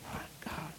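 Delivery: a hushed, stunned aside; awed whisper. Real, not acted. Preacher overcome by Joseph's tears, whispering. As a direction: breathe it out, barely a whisper.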